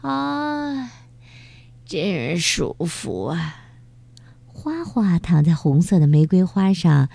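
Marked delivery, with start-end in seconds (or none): 0.0-3.6 contented sigh. Acted. Huahua enjoying the afternoon.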